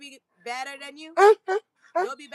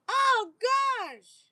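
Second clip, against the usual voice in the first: amazed oh gosh; 11 semitones higher, 4 dB louder than usual. Real, not acted. Amazed at catch.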